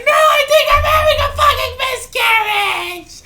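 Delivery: high crying shriek; mock her sobbing panic; wailing girl. Bleeding girl wailing back at her friend. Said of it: "She's like".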